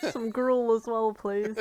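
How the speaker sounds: ordinary delivery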